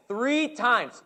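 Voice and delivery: usual speaking voice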